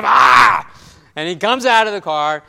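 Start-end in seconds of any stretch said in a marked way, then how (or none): 0.0-0.7 loud, frustrated "Ah!" of giving in; a husband's loud groan of defeat; husband caving to his wife's finger, groaning loudly; exasperated groan. Retold or imitated. Husband gives in to pointing wife.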